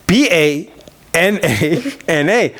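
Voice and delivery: slow, letter by letter spelling; B A N A N A said letter by letter, slowly. Shown as a demonstration. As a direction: spell each letter out slowly to make the point.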